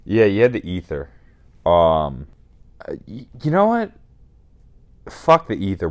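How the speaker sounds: normal talking voice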